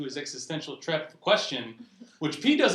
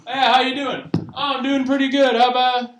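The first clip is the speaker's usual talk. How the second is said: mock cheery greeting; 9 semitones higher, 7 dB louder than usual. Acted greeting exchange, high and loud.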